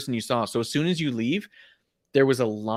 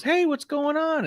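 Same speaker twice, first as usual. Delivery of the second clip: imitating Colt's bright call, pitched way up and slowed; friendly greeting; slower than usual. Wrestler greeting a shy fan, bright high call. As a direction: play the star calling out warmly to a starstruck fan. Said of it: "he just looks over and he goes".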